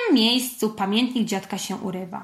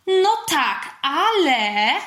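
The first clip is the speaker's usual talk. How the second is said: high-voiced, uncertain objection; hesitant; 6 semitones higher, 4 dB louder, much slower than usual. A narrator's reading. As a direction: cut in with a drawn-out doubt. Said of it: "wtrąciła niepewnie Klodella". (niepewne wtrącenie)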